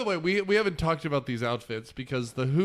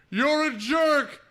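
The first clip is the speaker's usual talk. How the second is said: high, louder, slow yell at the echo; Grinch yelling; 11 semitones higher, 6 dB louder, slower than usual. Retold or imitated. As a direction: shout it at the mountain. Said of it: "And he goes".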